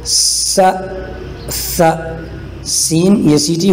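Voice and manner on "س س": س as a long voiceless hiss; voiceless whistling س. Shown as a demonstration. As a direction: hiss the س through the teeth. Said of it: "اور اسی طرح آگے چلیے".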